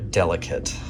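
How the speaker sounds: ordinary delivery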